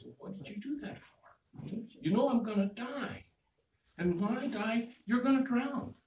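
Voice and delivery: high, hushed protest; dismayed frog. Acted. Stung frog questioning the scorpion, dismayed.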